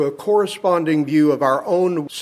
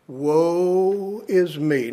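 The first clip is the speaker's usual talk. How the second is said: slow, drawn-out mock lament; much slower than usual. Preacher voicing Isaiah crying out, slow drawn lament. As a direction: stretch it into a slow groan of dread. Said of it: "I cried out".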